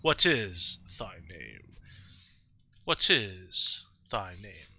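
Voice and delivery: very slow, low, solemn deity; Arceus, godlike narrator. Acted. Player voicing Arceus asking the hero's name, slow and solemn. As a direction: stretch each word with ancient weight. Reads the game's Arceus dialogue aloud.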